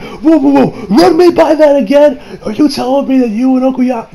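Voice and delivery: high, loud incredulous burst; mock stunned disbelief. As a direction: act out shock at startling gossip. Voicing Josuke's disbelief at news.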